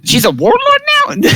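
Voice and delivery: very high, incredulous question; startled squeal of a question; astonished outburst. Acted. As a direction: blurt it in high-pitched disbelief. Player blurts surprise at the news.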